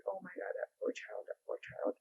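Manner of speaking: unvoiced whisper of pity; horrified whisper. Real, not acted. Mom grieving the murdered child, whispering in shock. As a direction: breathe it out, too shaken to speak.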